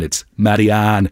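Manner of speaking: Marianne in a slow Dublin accent. Shown as a demonstration. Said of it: "do it in the Dublin accent".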